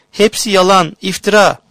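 high outraged cry; indignant cry. Acted. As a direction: fling the denial out loud. Sister denies accusation furiously.

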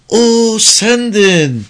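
high, loud, drawn-out realization; delighted exclamation. A narrator's reading. Girl suddenly recognises an acquaintance, delighted cry. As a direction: slowly cry it out in joyful recognition. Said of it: "Ağzında sevinçli bir gülüş belirdi".

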